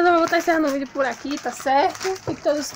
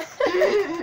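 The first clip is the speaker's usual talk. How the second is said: laughing; 4 semitones higher, 4 dB quieter than usual. Real, not acted. Laughs at brother's plug.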